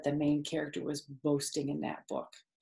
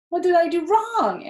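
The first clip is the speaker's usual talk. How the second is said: much higher, louder puzzled squeal; bewildered pig; 12 semitones higher, 8 dB louder than usual. Acted. Wilbur after his fall, much higher and confused. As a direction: ask like a dazed little pig. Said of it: "asked the pig".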